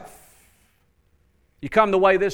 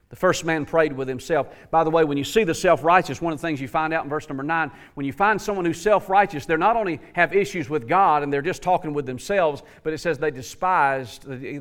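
normal talking voice

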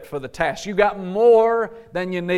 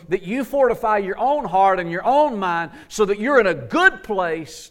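higher, insistent preaching push; forceful exhortation; 4 semitones higher, 3 dB louder than usual. Acted. Preacher telling believers to guard their hearts, forceful. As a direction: drive home that you gotta do it.

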